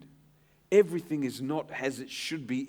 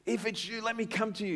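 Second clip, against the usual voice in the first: eager plea; 8 semitones higher, faster than usual. Retold or imitated. Peter voiced higher.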